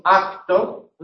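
"acto" with k voiced like g, "agto". Shown as a demonstration. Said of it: "la sonorizamos".

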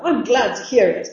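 loud, punchy acted exclamation; glad cry. Rival who fancies his chances, glad loud cry. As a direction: exclaim it eagerly. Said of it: "cried the other".

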